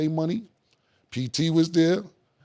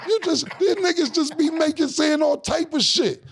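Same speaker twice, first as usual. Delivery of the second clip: amused rant; 12 semitones higher than usual. Real, not acted. Amused rant, high pitched.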